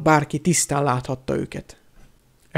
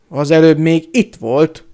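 loud, slow angry insistence; dühös bizonygatás; 7 dB louder than usual. A narrator's reading. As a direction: insist angrily, leaning on the words. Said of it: "bizonygatta dühösen a hóhér". (dühösen, hangosan bizonygatva)

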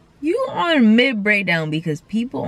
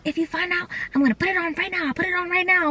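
mimicked homegirl; 7 semitones higher, 4 dB quieter, much faster than usual.

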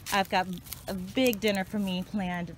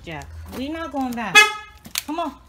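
ordinary talk, nothing marked

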